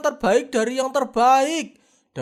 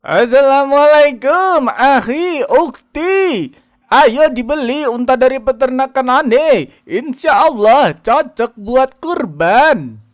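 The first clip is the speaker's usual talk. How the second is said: promo seller pitch; 8 dB louder than usual. Acted. Camel seller promo video.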